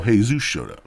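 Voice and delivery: ordinary talk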